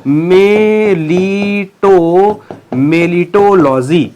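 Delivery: "मेलिटोलॉजी" stretched syllable by syllable; long, held syllables in "मेलिटोलॉजी" for writing down; slow dictation. Shown as a demonstration. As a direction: dictate "मेलिटोलॉजी" very slowly, holding each vowel. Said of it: "एक शब्द लिखिएगा".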